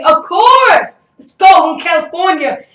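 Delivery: high, loud, elated retold answer; jubilant. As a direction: crow it back triumphantly. Retells his jubilant answer to dad.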